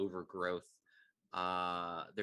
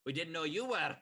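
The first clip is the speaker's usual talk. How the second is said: yelled retort; 6 semitones higher than usual. Acted. Duergar answer the yelled warning.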